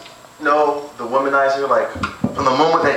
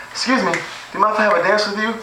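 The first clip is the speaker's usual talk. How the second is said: put-on girl at the club; 7 semitones higher, faster than usual. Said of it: "She goes".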